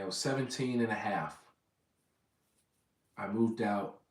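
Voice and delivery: ordinary delivery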